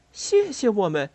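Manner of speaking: high-pitched, incredulous echo; astonished. A narrator's reading. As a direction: repeat it as a surprised question. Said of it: "菲比惊讶极了". (惊讶地反问)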